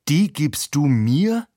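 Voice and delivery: high, slow, loud disbelieving cry; stunned shout. A narrator's reading. Slapped Heinz shouts at Jenny in disbelief. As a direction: yell it, unable to believe the slap. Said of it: "schreie ich fassungslos".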